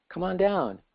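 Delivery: high, coaxing storybook call; calling invitation. A narrator's reading. Frog in the well urges visitors to join him, calling out. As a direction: call them down with a lifted pitch.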